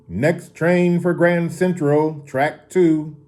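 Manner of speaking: high, drawn-out station announcer; loudspeaker announcement. A narrator's reading. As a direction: call out the train loudly and slowly over the station. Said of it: "Over a loudspeaker came a voice".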